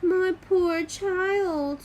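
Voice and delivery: put-on slow, gentle old woman; old woman comforting a frozen child; tender pity. A narrator's reading. Kind old woman pities Gerda.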